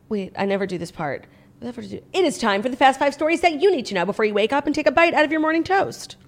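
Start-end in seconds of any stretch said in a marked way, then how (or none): none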